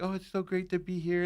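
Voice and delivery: mild imitation of a shy little kid; small kid voice. Imitating the Make-A-Wish kid's small normal voice. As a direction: say it sweet and small. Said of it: "He's like, just like".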